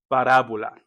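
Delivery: normal talking voice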